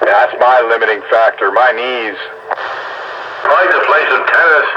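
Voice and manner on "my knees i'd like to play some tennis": usual speaking voice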